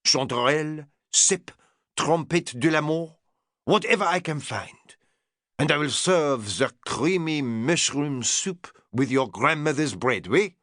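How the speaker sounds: put-on strong French accent, proud and grand; French chef. French chef boasting his menu to Lord Errington, strong French accent.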